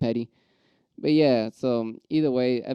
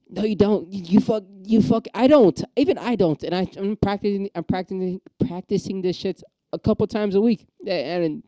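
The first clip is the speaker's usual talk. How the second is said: riled-up rant; 7 semitones higher than usual. Real, not acted. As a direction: let the frustration boil over, pitched way up. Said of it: "I'm getting riled up again".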